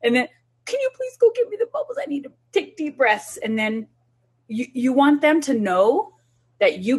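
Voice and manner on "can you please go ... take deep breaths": high, fast mock plea; pleading for bubbles. Shown as a demonstration. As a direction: rush the request up high as a demo. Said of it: "go in there and say".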